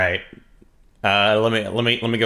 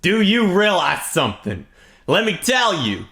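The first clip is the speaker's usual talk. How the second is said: mock rant; 8 semitones higher, 4 dB louder than usual. Mock rant at slow page.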